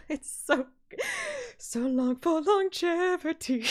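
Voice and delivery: high, laughing through the words; real delighted laugh-talk; laughing gush. Real, not acted. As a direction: giggle while raving. Laughing while gushing.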